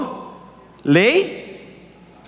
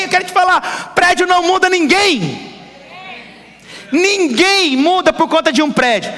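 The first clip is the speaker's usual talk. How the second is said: full-force preaching; 9 semitones higher than usual. Acted. Pastor tells the congregation buildings change no one, fiery preaching. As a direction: drive it home to the congregation.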